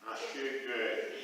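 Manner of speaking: low, quiet, slow and glum; glum reply. Acted. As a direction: mutter it glumly with a little laugh.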